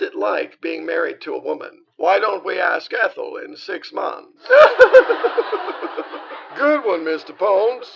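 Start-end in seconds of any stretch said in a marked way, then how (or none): 4.5-6.5 loud, high burst of laughter; big laugh. Acted. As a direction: roar with laughter at the zinger. Big laugh after the punchline.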